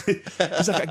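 usual speaking voice

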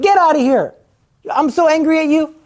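high, loud mock-angry wife; wife rejecting her husband, high and loud. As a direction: snap at him to get out.

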